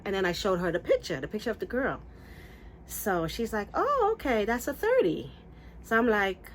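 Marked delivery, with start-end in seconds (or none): 3.7-5.3 high-pitched imitation of a breezy hairdresser; lilting retold agreement, starting way up; hairdresser's reply. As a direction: chirp it back knowingly.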